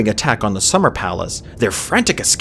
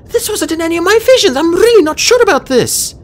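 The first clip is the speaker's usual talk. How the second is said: high, rushed, squeaky worry; fretful high-pitched young dragon; anxious; 6 dB louder than usual. A narrator's reading. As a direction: blurt your doubts nervously, growing louder. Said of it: "Fatespeaker whispered anxiously".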